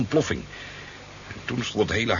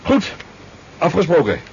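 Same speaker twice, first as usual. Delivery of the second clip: much higher, louder cheerful farewell; hearty goodbye; 10 semitones higher, 7 dB louder than usual. Acted. Fisherman taking his leave of the officers, hearty goodbye. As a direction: bid the gentlemen a bright, breezy farewell.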